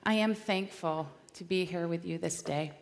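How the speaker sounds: normal talking voice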